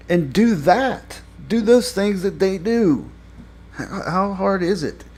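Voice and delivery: high, emphatic exasperated appeal. Real, not acted. Reviewer exasperated, addressing the show's writers.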